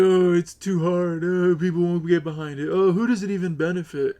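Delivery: high, loud mock whining; mocking imitation. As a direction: exaggerate the other guy's complaints, high and loud. Mockingly voices his opponent's objections.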